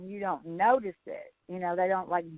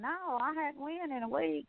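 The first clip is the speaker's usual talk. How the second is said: offhand; 5 semitones higher, 7 dB quieter than usual. Retold or imitated. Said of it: "say it like it was nothing".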